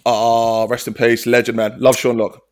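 ordinary delivery

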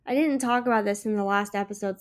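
ordinary talk

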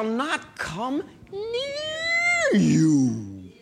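a long, stretched-out proclaiming cry; drawn-out shout. Real, not acted. Preacher shouting the verse to the congregation, stretched out.

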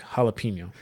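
jalapeno with "peen" in the middle, said softly; mispronounced jalapeno. Retold or imitated. Mocking a friend's point, soft mispronounced jalapeno as hala-peen-yo. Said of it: "that is literally someone saying, like".

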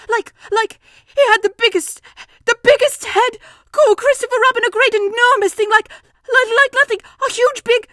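high, loud, breathless Piglet squeal; panicked stammering. Acted. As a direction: grope frantically for the words. Panicked Piglet describes the monster.

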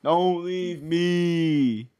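very slow stretched whine; drawn-out plea. Retold or imitated. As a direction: drag the plea out slowly. Drawn-out echo.

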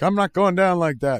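loud, high, defiant cry; defiant shout. Acted. Pig refusing capture, loud defiant shout. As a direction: shout it like a cornered fighter. Acted character defiant line.

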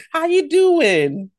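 slow, loud, stretched greeting; loud drawn-out greeting. Acted. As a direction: belt out the hello. Greeting cohost loudly.

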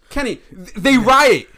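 loud, high-pitched interjection; emphatic protest. Real, not acted. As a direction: cut in loudly to correct a friend.

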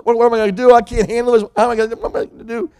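high, loud, rushing panic; mock panicked pleading; panicked worrying. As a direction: fret like someone losing control in the storm. Fearful people in the storm.

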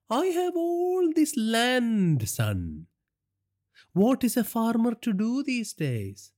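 high, slow, amused villain voice; mocking crime boss eyeing his captive; gloating gangster boss. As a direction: savour it like a cruel boss. Villain voice for Naresh.

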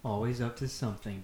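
ordinary talk

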